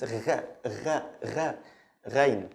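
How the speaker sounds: French-R "gha"; GH from tongue's end. Shown as a demonstration. Teacher repeating for learners, French-R GH. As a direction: GH made at the back of the tongue. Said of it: "the end of your tongue".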